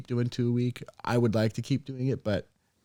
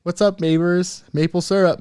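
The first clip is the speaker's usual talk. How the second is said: mock Canadian accent; 6 semitones higher, 4 dB louder than usual. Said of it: "trying to speak in all of these languages".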